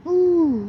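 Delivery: high squeaky ooh of a game hero; playful high-pitched ooh; squealed ooh. Pretend. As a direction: pop out a tiny high cartoon ooh. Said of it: "I'm a Mario".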